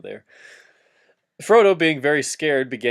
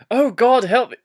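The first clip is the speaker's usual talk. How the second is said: higher, louder, melodramatic plea; mock desperate prayer; 9 semitones higher, 5 dB louder than usual. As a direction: beg the heavens like a cartoon hero. Frodo praying, voiced.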